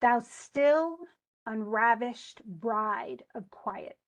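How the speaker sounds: slow, with STILL, RAV, BRIDE and QUI stressed; stressed metrical reading. Shown as a demonstration. Teacher reading for the student to hear stresses, over-emphasized meter. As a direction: beat out Thou STILL unRAVished BRIDE of QUIetness. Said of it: "I'll just read it to you".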